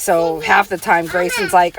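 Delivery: normal talking voice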